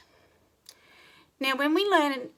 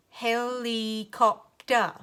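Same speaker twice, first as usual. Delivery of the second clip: HELICOPTER slow, in four even chunks; robot talk; 2 semitones higher, 3 dB louder, much slower than usual. Shown as a demonstration. Teacher counting syllables with children, slow robot HELICOPTER. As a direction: say HELICOPTER like a robot, he-li-cop-ter. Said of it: "say it slowly like a robot".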